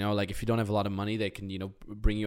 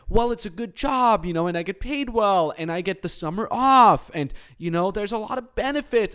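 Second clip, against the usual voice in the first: high, sing-song sensible excuse voice; young [name] justifying a safe career, mock-earnest; mock rational reasoning; 9 semitones higher than usual. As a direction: parody his own safe reasoning. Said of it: "quote unquote rational thinking".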